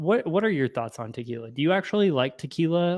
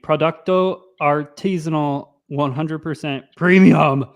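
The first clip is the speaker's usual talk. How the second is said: loud reading; 7 dB louder than usual. Shown as a demonstration. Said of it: "I'm gonna read every word".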